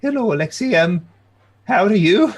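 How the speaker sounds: put-on high, nervous old-lady greeting. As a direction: greet him politely despite the horror. Old lady greets whipped man.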